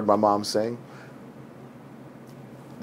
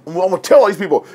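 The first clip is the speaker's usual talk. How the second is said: boastful student; 9 semitones higher, 7 dB louder, much faster than usual. Retold or imitated. Hungry college student boasting.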